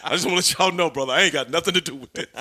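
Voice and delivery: friendly mock wrestler promo; imitating Sting. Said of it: "Sting go".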